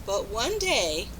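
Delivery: ordinary delivery, nothing marked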